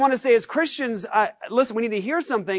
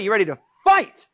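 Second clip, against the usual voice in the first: usual speaking voice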